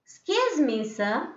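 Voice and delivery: "excuse me" lifted high before "sir"; high questioning lift on "excuse me"; rising tone. Shown as a demonstration. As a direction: raise the pitch on "excuse me, sir". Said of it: "that is rising".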